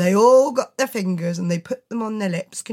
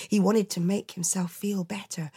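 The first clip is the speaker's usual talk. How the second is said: plain reading voice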